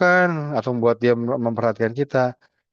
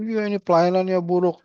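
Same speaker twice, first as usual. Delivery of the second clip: mild mock complaint; 7 semitones higher than usual. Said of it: "marah-marah".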